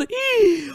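long, high-pitched gasp; shocked gasp. Acted. Headman finding the well empty, shocked gasp. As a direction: suck in a theatrical gasp.

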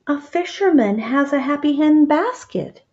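calls it out from across the market; mild shout from a distance. Acted. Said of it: "he shouted".

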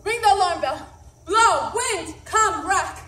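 high, loud commanding shouts; rally the castle to arms; battle cry shout. Acted. Macbeth calls to arms.

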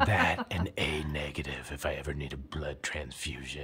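low, hushed seducer; soft, deep smooth-talker voice. Shown as a demonstration. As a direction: murmur it low like a smooth lover. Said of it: "whispering and trying to be, like, Rico Suave".

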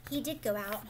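ordinary delivery, nothing marked

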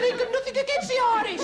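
very high, loud squawk of denial; indignant protest. Acted. Passenger denying the conductor's accusation, indignant. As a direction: protest loudly, voice leaping right up.

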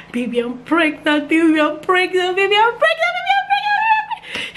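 building from excited to a very high joyful shout; ecstatic calling. Real, not acted. Calling husband with pregnancy news.

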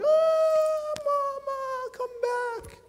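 high, loud drawn-out wail of a mourner; mock wailing. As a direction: wail for Mama, hammed up. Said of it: "crying most at the janazah going".

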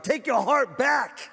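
high, slow shout; shouted exhortation. Real, not acted. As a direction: bellow it out to the church.

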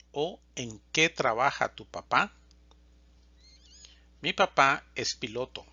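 ordinary talk

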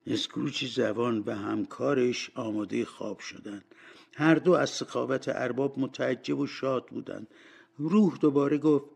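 ordinary delivery, nothing marked

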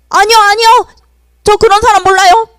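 high, loud, fast denial; panicked denial. Acted. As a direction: deny it in a flustered rush. Peter denying Jesus.

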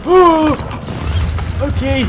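shrill shriek of fright; terrified horror victim. Real, not acted. Sudden scare in game.

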